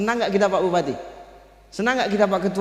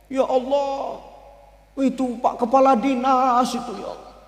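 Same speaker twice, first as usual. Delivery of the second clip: acted tearful dismay; mock weeping; 5 semitones higher than usual. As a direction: whimper upward, stretching the final words. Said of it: "Nangis Rasulullah".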